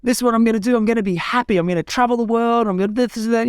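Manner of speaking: high, eager rush of big plans; excited exclaiming. Retold or imitated. Telling aunt and uncle his plans, excited high exclaim. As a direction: announce it like the best news ever. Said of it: "I exclaimed".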